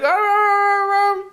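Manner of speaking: very high sung whimpering; dog whimper. Retold or imitated. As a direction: yelp it like a scared dog. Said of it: "He's like".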